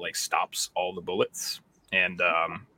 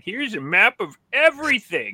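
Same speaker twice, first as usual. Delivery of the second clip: mock government voice; 12 semitones higher, 6 dB louder than usual. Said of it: "to be like".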